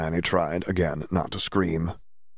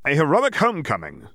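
grand headline voice, pitched up; newspaper headline; 9 semitones higher than usual. Acted. Reading the Prophet's cover aloud, mock grand headline. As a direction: announce it like a tabloid banner. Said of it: "the paper read".

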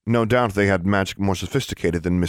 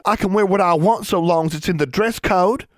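defiant; 12 semitones higher, 4 dB louder than usual. A narrator's reading. Defiant sister in argument.

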